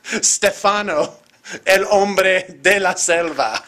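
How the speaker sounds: put-on slow, grand Spanish announcing in Mexican accent; hammed-up Mexican jungle-man introduction. Host introducing the Mexican man of the jungle, showy Mexican accent. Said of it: "it's the Mexican".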